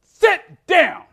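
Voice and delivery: loud, high, sudden order; commanding shout. Pretend. Teacher ordering a standing student, sharp and loud. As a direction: bark it to silence the room. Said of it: "you're gonna go".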